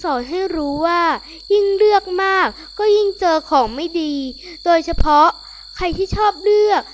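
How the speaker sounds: soft teaching narrator; gentle moral. A narrator's reading. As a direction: tell the lesson gently. Story moral to children.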